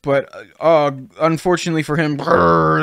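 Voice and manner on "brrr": mouthed rage sound effect; imitated rage growl. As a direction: growl out a pretend power-up. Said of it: "that's my rage".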